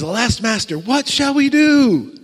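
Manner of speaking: high theatrical wail, stretched at the end; mock-panicked cry, climbing in pitch; hammed-up dismay. Servant facing a great army, theatrical dismay.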